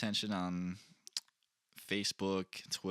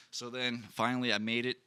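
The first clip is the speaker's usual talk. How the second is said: ordinary talk, nothing marked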